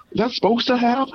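high mock puzzled girl; imitating her puzzlement; girl's voice. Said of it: "It's like".

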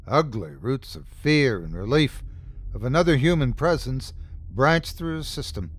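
usual speaking voice

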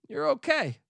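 way up high, a sing-song you're okay; high reassurance. Acted. Telling the angry listener it's fine, high and sing-song. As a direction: soothe yourself in a high, light voice. Reassuring after throwing sunglasses.

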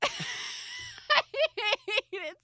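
laughing so hard; cracking up mid-sentence. Real, not acted. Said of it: "Are you crying?".

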